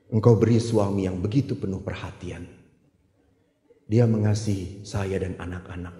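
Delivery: soft dictated prayer. Retold or imitated.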